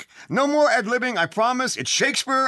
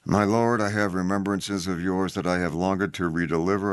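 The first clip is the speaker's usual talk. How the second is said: low, dead, flat monotone; monotone serial killer. Acted. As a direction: read it like a flat deadpan killer. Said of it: "monotone serial killer".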